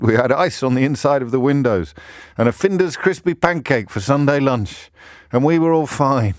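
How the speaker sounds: gently mock a northern nostalgic in northwest English; northwest English accent. Repeating the Facebook post in a light northwest English accent. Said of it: "went northwest there in my accent".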